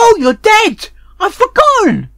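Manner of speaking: high, loud horrified yelling; shocked outcry. Real, not acted. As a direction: yell in dramatic dismay at the death. Realises Apple Bloom died in fire.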